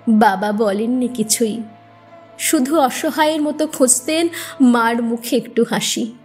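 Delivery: ordinary delivery, nothing marked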